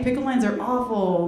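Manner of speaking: ordinary delivery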